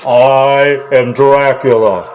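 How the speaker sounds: slow Dracula through an echo mic; toy echo microphone. Acted. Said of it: "here's my Echo mic".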